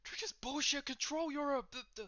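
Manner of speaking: high-pitched sputtering rant; loud, high, stumbling indignation; furious. Acted. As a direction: lose your words from sheer outrage. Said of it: "now just getting furious at you".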